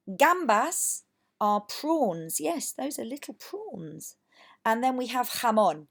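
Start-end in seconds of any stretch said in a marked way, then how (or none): 2.4-4.2 slow whispered aside; playful whispered aside. Real, not acted. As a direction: whisper it like a secret. Aside about prawns.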